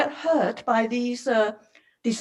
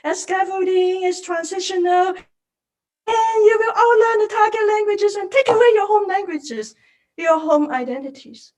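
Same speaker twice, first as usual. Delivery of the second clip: sarcastic imitation, pitched up; mocking teacher; 8 semitones higher than usual. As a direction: parody the dismissive teacher voice. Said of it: "It's not just".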